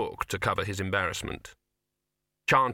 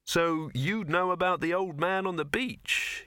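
lightly voiced young man, slow and curious; a hint of an earnest young man asking slowly; Victor; 8 semitones higher, slower than usual. Victor speaking.

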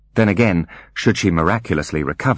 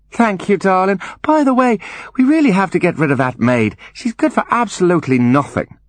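high, grand lady voice; high-pitched haughty complaint; Angeline, imperious mother; 14 semitones higher, 3 dB louder than usual. Acted. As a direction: dismiss the servant in a lofty high register.

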